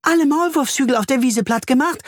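very high-pitched little bee voice; high, eager character voice; bee cutting in. A narrator's reading. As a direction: jump in to finish the sentence. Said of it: "beendet Bertha den Satz".

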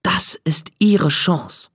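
slow whisper, conspiratorial; hushed and slow; whispered aside. A narrator's reading. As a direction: share a secret with the listener. Narrator's secret thought.

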